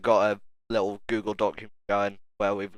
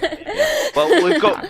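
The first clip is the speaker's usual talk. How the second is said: laughing; 7 dB louder, much slower than usual. Real, not acted. Laughing while talking.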